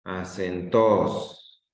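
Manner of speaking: "Acentos" slowly as a-cen-TOOOS, falling; stress on last syllable. Shown as a demonstration. Teacher testing the student's answer, "Acentos" stressed on "tos". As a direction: stretch and hit the final "tos" of "Acentos". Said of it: "voy a leerlo fuerte en donde dices tú".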